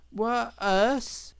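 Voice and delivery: silly rabbit. Acted. The scared rabbit answering Otter, startled and silly. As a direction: squeak out the dim reply.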